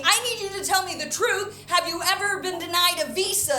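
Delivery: high-pitched exasperated demand; retold yell. Border agent finally yells at traveler, high and exasperated. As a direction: raise the pitch and demand it, imitating a fed-up official. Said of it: "she finally yells at me".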